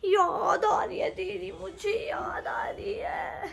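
slow, soft whimpering imitation of her sister; mock whiny missing home. Mimicking her sister's homesick whine, slow and soft. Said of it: "फिर कहती है".

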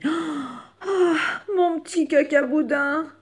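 high gasp, then loud fond cry; delighted reunion. Acted. Papa rabbit finding his little one, high delighted cry. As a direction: exclaim with high-pitched joy.

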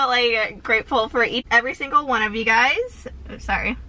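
ordinary talk

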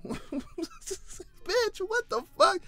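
high, laughing what-the-fuck; laughing disbelief. Real, not acted. Vlogger cracking up at the filing, high laughing shock.

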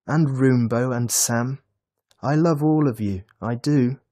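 put-on gushing child's affection; happy, loving boy. A narrator's reading. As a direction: declare love to everyone in turn. Boy declaring love for his family.

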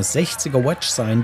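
ordinary talk, nothing marked

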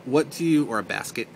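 normal talking voice

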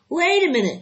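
put-on high, loud boy's shout; excited cry. A narrator's reading. Avery spotting the prize tag, excited cry. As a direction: stop everyone with a shout. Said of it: "cried Avery".

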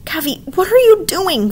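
startled brother calling out; alarmed big brother. A narrator's reading. Vic catching his sister feeding dragons.

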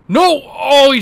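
loud, high cry of frustration; dismayed shout. Real, not acted. Player sees target dodge at last second, dismayed shout. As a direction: shout "no" high, then ease down.

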